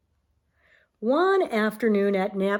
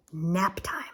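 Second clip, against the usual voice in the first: whisper; 4 semitones lower, 5 dB quieter than usual. A narrator's reading. Sleepy word in bedtime story.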